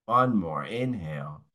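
calm, unhurried breathwork guidance with long pauses; guided breathing cues. Acted. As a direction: lead the class through each breath gently.

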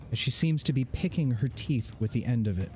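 ordinary talk, nothing marked

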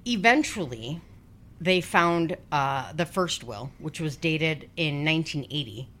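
usual speaking voice

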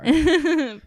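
loud, high laugh bursting out; real delighted laugh; burst of laughter. Real, not acted. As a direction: crack up at the teasing. Friend teases her about identity.

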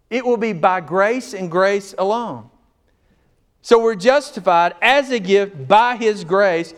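high, loud, rising preacher's cry; full-force preaching. Acted. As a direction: preach it out to the whole room.

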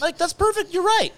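high mock-cheerful agreement; sarcastic high voice.

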